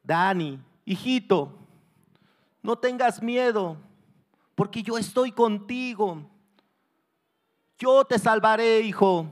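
loud, insistent reassurance; encouraging the son. Acted. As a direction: call out courage to a frightened child. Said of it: "seguía animando al hijo".

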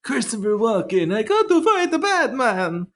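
high cartoon Italian voice; a Walken that slides into Mario; Mario-ish Walken. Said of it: "slip into a Mario".

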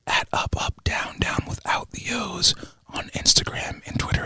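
breathy close-mic whisper, unhurried; ASMR whisper. Acted. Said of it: "A S M R".

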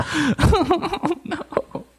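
very high, fading "Oh, no"; breathy high dismay; mock horror. Real, not acted. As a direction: squeal in horror. Horrified reaction.

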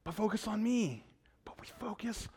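whisper. Acted. God's answer, then pastor's echo.

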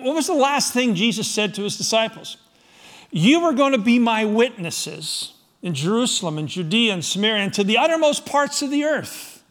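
usual speaking voice

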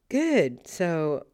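high, chirpy nervous cover; forced cheer. Retold or imitated. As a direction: keep it light though scared. Wife forcing calm before confrontation.

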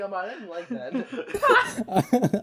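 slow, laughing mock complaint; hurt feelings. Hurt friend complaining to Ahmad, laughing mock upset. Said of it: "he just started hurting my feelings".

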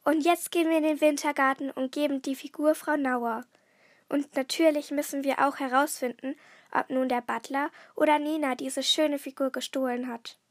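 ordinary delivery, nothing marked

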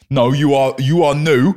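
loud, emphatic comeback; punchy mock-argument voice; mock indignant retort. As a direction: push back hard, playing the offended user. Acting out the user's blunt retort.

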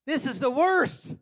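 high, loud and slow; loud wail. Shown as a demonstration. Stuck in the drive-thru, loud high wail. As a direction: howl in exasperation. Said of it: "I'm, yes".

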